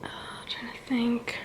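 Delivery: slow, very soft whisper; whispered thinking aloud. Real, not acted. Vlogger trying to think who else was there, whispering. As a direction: murmur it to yourself, searching memory.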